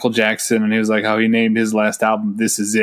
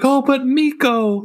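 mock pleading; 13 semitones higher, slower than usual. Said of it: "everyone's like".

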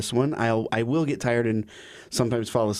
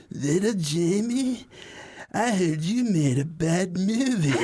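cousin voice; 7 semitones higher, slower than usual. Retold or imitated. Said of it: "she goes".

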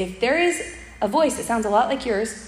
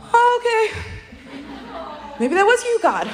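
stunned realisation; 11 semitones higher than usual. Retold or imitated. Realising God prompted her kindness, high amazed half-laugh. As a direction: gasp it out in amazed disbelief.

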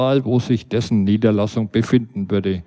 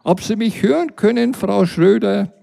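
high, raised call through glass; shouting; 8 semitones higher than usual. Retold or imitated. Narrator shouts through the glass door to the neighbour. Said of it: "schrie ich jetzt so laut, wie ich konnte".

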